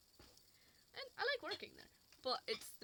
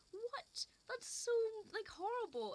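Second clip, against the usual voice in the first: outraged disbelief; 11 semitones higher, 5 dB louder, slower than usual. Real, not acted. Venting about rude customers.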